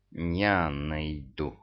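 slow sinister hiss; low, menacing, drawn out; mustache twirling villain. A narrator's reading. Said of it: "зловеще прошипел Мурзик".

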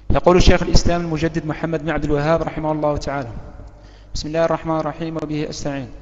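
ordinary talk

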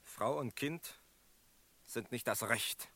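quietly firm. Acted. Quiet insistence.

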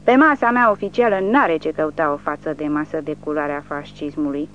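ordinary delivery, nothing marked

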